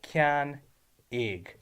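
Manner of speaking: "Ceann, ig" broken in two with a clear gap; split into syllables. Shown as a demonstration. Teacher lets learners hear two syllables, "Ceann, ig" split apart. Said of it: "two syllables, and you can hear that".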